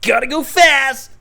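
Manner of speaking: high, loud, drawn-out catchphrase; Sonic. Acted. [name] takes his turn, loud high cartoon hero. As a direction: belt it high with a long last word. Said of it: "[name] doing a Sonic impression".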